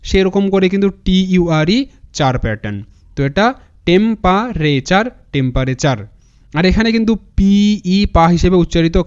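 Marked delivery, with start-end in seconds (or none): 3.9-5.0 slow, divided into spoken chunks; syllable by syllable. Shown as a demonstration. Teacher joins the word's parts for students, syllable by syllable. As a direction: sound out temperature part by part, ending on a rise. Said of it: "এরকম করে উচ্চারণটা করবে".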